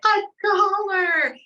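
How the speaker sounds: put-on high, loud, drawn-out ecstatic cry of a little boy; a thrilled child shrieking over a dollar; kid losing his mind. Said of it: "he loses his mind".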